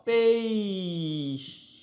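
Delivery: "peixe" drawn out as a long PEI, pause, then xe; syllable by syllable. Shown as a demonstration. Teacher separating syllables, "peixe" slowly as pei... xe. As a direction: split "peixe" in two, holding the first syllable very long. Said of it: "se eu fosse separar a palavra peixe".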